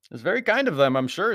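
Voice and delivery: high, loud, airy politeness; mock gratitude. A narrator's reading. Anthony answering the threat, high mock-polite. Anthony mockingly thanks the villain.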